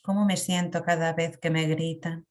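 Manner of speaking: usual speaking voice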